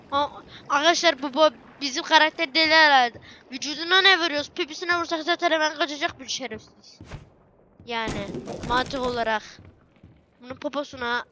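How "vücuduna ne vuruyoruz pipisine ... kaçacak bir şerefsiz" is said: ordinary talk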